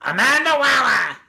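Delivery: hammed-up name call, pitch arching up then down; signature name call. Shown as a demonstration. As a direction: belt out the name in a put-on voice. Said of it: "how do you say it".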